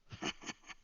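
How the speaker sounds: soft, low-key breathy chuckle; quiet villain's laugh; sinister chuckle. Acted. Wizard amused at Stella refusing, sinister chuckle.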